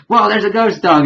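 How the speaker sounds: high, loud cry of astonishment; mock freak-out. Spider-Man meets a talking ghost dog, mock freak-out. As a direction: act out a startled, excited outburst. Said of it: "they were freaking out over each other".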